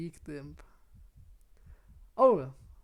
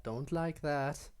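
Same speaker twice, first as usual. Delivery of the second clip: ordinary delivery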